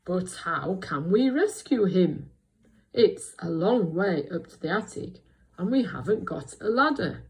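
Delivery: loud, projected wise character; big storybook voice, deliberate; Bramwell. Shown as a demonstration. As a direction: read it out loudly as a concerned question. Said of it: "And then he says".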